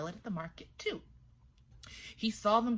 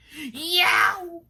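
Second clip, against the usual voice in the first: high loud yelp; loud high scared cry; startled yelp; 11 semitones higher, 13 dB louder than usual. A narrator's reading. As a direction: jump in fright.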